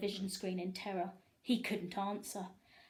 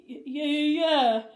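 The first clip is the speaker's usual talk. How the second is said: nervous stammer; 6 semitones higher, 12 dB louder, slower than usual. A narrator's reading. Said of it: "Harold stammered nervously".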